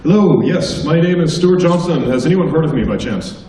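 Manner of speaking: ordinary talk, nothing marked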